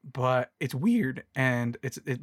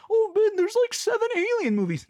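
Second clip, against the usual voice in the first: mock excited fan. Mocking hyped fans of the alien movies.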